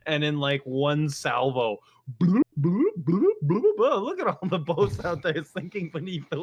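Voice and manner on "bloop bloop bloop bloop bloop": higher, louder playful bloop noises; bloop sounds. Retold or imitated. Storyteller mimicking sinking boats, playful bloops. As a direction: pop each bloop like a shell hitting. Imitated shells sinking ships.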